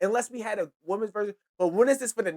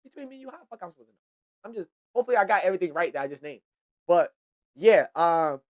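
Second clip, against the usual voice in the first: ordinary talk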